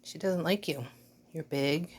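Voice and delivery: normal talking voice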